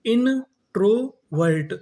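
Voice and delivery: Introvert stretched very slowly; slow syllable by syllable. Shown as a demonstration. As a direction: sound it out slowly. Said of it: "तो पूरी meaning". (धीरे-धीरे)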